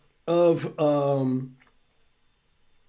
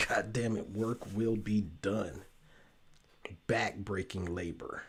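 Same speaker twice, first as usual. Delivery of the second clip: very low, soft storytelling voice; low, measured voice-over under the breath; hushed mock narration; 10 semitones lower, 14 dB quieter than usual. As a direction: narrate it quietly and solemnly, with weighty pauses.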